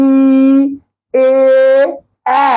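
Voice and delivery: vowels with lips pulled tight; spread lips. Shown as a demonstration. Teacher demonstrating spread-lip vowels. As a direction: stretch the lips wide on each vowel. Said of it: "প্রসূত হচ্ছে ঠোঁটগুলা টান টান থাকবে".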